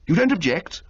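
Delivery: can't quite believe it; surprised young toff. Acted.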